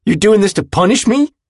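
high, loud, accusing question; livid. A narrator's reading. Willem accuses his friend in the car, livid. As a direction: accuse him in outrage. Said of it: "makes him newly livid".

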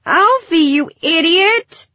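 high, loud, drawn-out exasperation; exasperated groan. A narrator's reading. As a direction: scold a hopeless fool at high pitch. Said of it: "Gilly groaned".

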